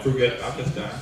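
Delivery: put-on "Forget Pakistan" in a soft, low Pakistani accent. Pakistani player quitting his country, in a Pakistani accent. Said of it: "They say it with an accent".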